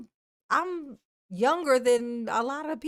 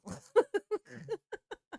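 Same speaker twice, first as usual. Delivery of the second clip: high laugh; 10 semitones higher, 3 dB quieter than usual. Real, not acted. Laughs after welcome.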